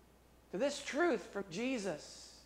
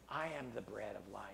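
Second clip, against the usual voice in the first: quiet, intimate declaration; soft Jesus voice; 14 semitones lower, 11 dB quieter than usual. Acted. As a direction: say it softly, almost to yourself. Preacher voicing Jesus, soft take.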